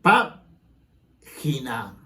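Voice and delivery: "página" very slowly, PÁ split from gi-na; drawn-out demonstration of "página": PÁ... gina; stress on antepenultimate syllable. Shown as a demonstration. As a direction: hit the first syllable of "página", pause, then let -gina fall. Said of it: "antepenúltima".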